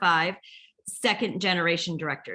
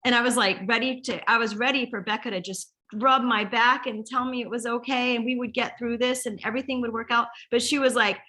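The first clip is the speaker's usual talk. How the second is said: ordinary talk, nothing marked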